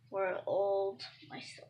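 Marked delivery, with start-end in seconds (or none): 1.3-1.6 startled hushed whisper. Real, not acted. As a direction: breathe it in surprise.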